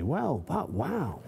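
drawn-out awe; slow amazed wow. Acted. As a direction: let the wow hang, amazed. Kay awed by ruined dish.

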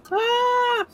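sudden high yelp of surprise; startled scream. Real, not acted. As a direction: yelp as something jumps you.

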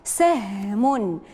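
extremely slow, stretched; سهم said very slowly with the vowel held; drawn-out model word. Shown as a demonstration. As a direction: pull سهم out long. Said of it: "انتقلوا الكلمة الأخيرة".

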